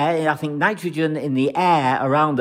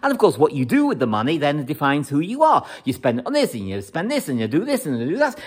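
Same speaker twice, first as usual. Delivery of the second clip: ordinary talk, nothing marked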